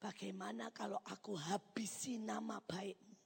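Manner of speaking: hushed, unhurried question; God speaking softly. Retold or imitated. God quietly testing a believer, hushed and grave. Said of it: "Tuhan ngomong begini".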